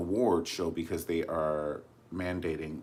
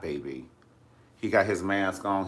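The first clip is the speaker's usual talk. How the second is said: ordinary delivery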